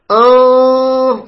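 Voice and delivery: أن held long and high through the nose; over-pressed nasal noon. Shown as a demonstration. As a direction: press hard on the nose for a drawn-out أن. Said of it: "لا تضغط على الخيشوم".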